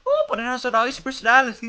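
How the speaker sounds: high, loud put-on voice; mock defender voice. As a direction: play a whiny defender.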